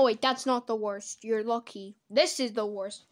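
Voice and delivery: usual speaking voice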